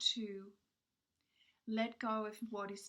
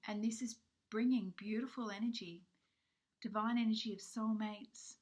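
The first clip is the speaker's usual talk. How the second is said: usual speaking voice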